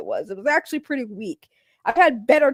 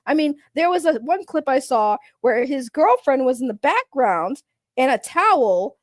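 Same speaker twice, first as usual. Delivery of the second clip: usual speaking voice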